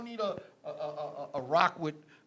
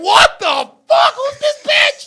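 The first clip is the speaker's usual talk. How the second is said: very high, shouted disbelief; shocked outburst; 10 dB louder, slower than usual. Retold or imitated. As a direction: yell it slowly in stunned outrage. Retelling his shocked reaction.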